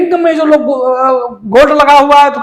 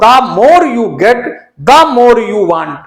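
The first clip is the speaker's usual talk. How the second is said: slow read-out of the corrected version; slow corrected sentence; much slower than usual. Shown as a demonstration. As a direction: spell out "The more you get, the more you want" slowly. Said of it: "दोनों जगह दा का प्रयोग करेंगे". (धीमा, सुधरा हुआ वाक्य)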